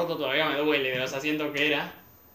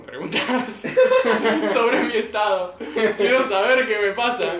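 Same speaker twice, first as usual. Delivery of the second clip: louder, laughing protest; 7 semitones higher, 7 dB louder than usual. Retold or imitated. Retelling asking the doctors questions, laughing. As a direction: push the question while laughing. Own complaint retold with laughter.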